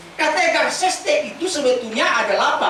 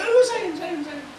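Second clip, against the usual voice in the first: tender mother; 5 semitones higher than usual. Retold or imitated. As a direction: coo to a crying baby at night. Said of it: "Nangis lagi".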